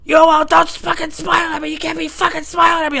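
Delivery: high, loud imitation of an angry man; screaming customer. Customer ordering the employee not to smile, angry screaming. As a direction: yell it as the outraged customer. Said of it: "screaming at each other".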